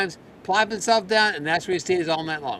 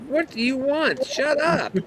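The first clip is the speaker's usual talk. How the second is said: pitched up, mock-exasperated at the dog; annoyed owner at dog; 9 semitones higher, slower than usual. Owner scolding his chatty puppy at midnight, mock exasperated. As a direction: scold the noisy puppy, half joking. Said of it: "going like".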